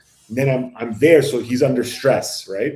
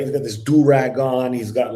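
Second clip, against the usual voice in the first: normal talking voice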